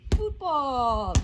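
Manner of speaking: \"football\" gritado com esforço ao chutar; loud effortful call. Real, not acted. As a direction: shout it out as you kick. Kicking the ball while saying it.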